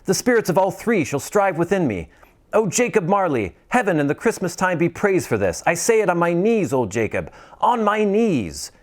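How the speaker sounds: put-on high, pleading old man voice; pleading Scrooge. Scrooge pleading with the silent spirit, high and desperate. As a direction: beg the spirit for mercy.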